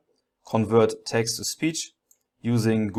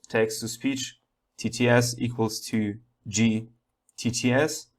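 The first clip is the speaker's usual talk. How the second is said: normal talking voice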